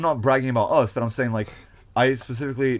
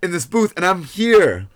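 high, loud, drawn-out dismay; appalled reaction; 9 semitones higher, 10 dB louder, slower than usual. Retold or imitated. Retelling his find in the library booth, appalled. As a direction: exclaim it in loud, high disbelief.

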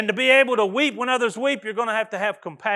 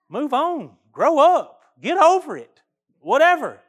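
raised, louder, impatient brush-off; dismissive brush-off; 6 semitones higher, 6 dB louder than usual. Retold or imitated. Minister to sobbing counseling couples, impatient and dismissive. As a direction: wave their tears away, done with it. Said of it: "and I was like".

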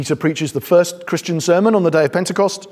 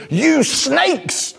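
higher, hammed-up accusing cry; mock prophet insult; 9 semitones higher than usual. Preacher imitating John opening a sermon, hammed-up insult. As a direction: fling the insult like a fiery prophet. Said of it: "that's what John says".

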